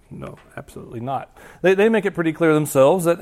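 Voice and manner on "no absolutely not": whispered no. Real, not acted. Emphatic denial, unvoiced.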